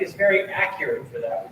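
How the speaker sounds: ordinary talk, nothing marked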